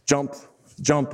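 spaced-out count of "jump", one at a time; measured count. Retold or imitated. Clown counting jumps behind the volunteer, slow measured count. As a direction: call each "jump" separately, with a gap between. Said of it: "it goes really fast".

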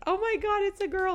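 high-pitched squeal of amazement; imitated excited dad. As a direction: gush it high, imitating overjoyed reaction. Dad's joyful reaction to news.

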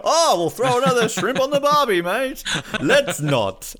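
very high, loud hammed-up film quote; Dumb and Dumber impression. Interviewer acting out Dumb and Dumber's barbie joke, loud mock voice. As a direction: burst into an exaggerated movie impression. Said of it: "reminds you of Dumb and Dumber".

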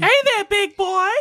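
very high mock female voice; exaggerated high-pitched seductress; hammed-up flirt. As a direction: squeal a flirty come-on in falsetto. Mocking a female role's voice.

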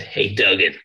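a hint of an American accent on the greeting; American accent impression. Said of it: "when I do an American accent".

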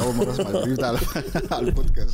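talking through laughter; laughing while speaking; كلام بضحك. Real, not acted. ضحك على بريف العطسة. (بيتكلم وهو بيضحك)

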